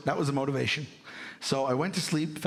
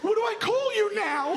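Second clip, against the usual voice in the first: put-on much higher, earnest little-kid question; confused kid. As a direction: ask it like a bewildered six-year-old. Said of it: "I went".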